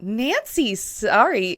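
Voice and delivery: drawn-out, slow "Nancy's sorry"; slow stretched. Shown as a demonstration. Showing how the line is not said, slowly. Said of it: "like, not".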